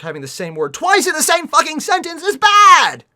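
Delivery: loud and high exasperated outburst; exasperated rant. Real, not acted. Ranting about the writing.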